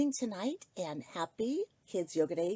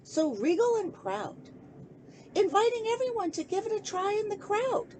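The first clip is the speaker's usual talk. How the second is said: put-on rhyming picture book read aloud to children; lilting sing-song storybook reading; story narration; 4 semitones higher, 4 dB louder than usual. A narrator's reading. Host reading a bedtime book to kids, gentle storytelling.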